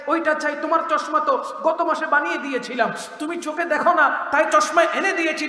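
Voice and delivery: a light imitation of a son scolding his mother; angry son. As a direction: act the son nagging his mother back. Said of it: "সন্তান তখন রাগ করে বলে".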